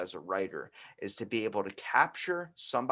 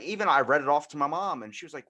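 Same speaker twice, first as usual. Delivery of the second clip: ordinary talk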